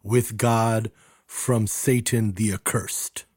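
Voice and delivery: very slow, deliberate English rendering; measured, careful explanation; slow solemn translation. Shown as a demonstration. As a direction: spell out the meaning slowly. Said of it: "that just literally means".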